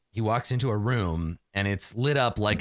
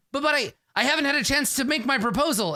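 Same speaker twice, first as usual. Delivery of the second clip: much higher, fast, desperate pleading; pleading nervous inventor; 13 semitones higher, faster than usual. Acted. Nervous inventor begging Ral for a chance, high and frantic. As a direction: squeak out a panicked plea.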